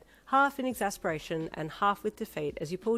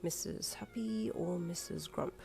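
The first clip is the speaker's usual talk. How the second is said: slow, soft whisper; 6 dB quieter, slower than usual. Acted. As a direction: whisper it to yourself. Whispered musing.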